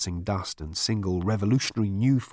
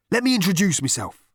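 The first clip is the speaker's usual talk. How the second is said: high, loud earnest voice; earnest man; 10 semitones higher, 6 dB louder than usual. Acted. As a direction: announce himself grandly. Rooster speaks.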